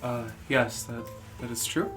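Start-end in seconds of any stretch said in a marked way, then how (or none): none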